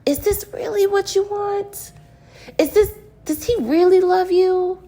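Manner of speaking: a small hidden voice asking; inner voice. Pretend. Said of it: "listen to that deep inner voice and say".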